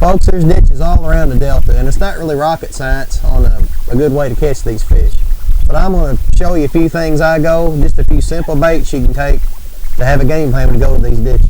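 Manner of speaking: speaking up to the camera; host telling the folks at home; presenter voice. Acted. Host addresses viewers, presenter read.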